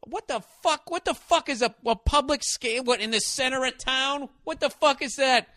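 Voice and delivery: high, baffled what-the-fuck; incredulous outrage. Real, not acted. Comic ranting at the public scale rule, high and baffled. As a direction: sputter it in disbelief. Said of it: "outraged disbelief".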